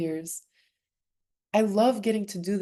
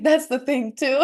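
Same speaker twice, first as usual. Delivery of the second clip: laughing; 7 semitones higher, 6 dB louder than usual. Real, not acted. Guest laughing as she echoes her own phrase. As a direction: quote it through a bright laugh, higher and louder.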